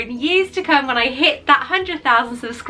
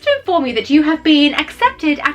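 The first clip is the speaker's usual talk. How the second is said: a stately, slightly raised reading voice; formal letter reading; 4 semitones higher than usual. Acted. Vlogger reading her Hogwarts acceptance to viewers, formal and lofty. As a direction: read it like an official letter, with ceremony.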